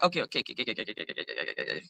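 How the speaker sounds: okays dying away to nothing; dismissive okays fading to silence. Retold or imitated. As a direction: trail off dismissively.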